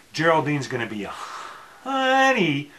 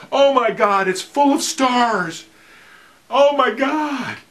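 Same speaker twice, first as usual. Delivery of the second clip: high, slow, loud awe; awestruck; 10 semitones higher, 5 dB louder than usual. Pretend. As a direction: gasp it out in wonder. Quoting film, awestruck.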